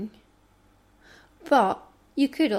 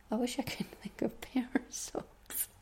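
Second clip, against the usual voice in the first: much softer whisper with a laugh; whispered laughing aside; 10 dB quieter than usual. Real, not acted. Joking to herself about wordplay, laughing whisper. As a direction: giggle it under your breath.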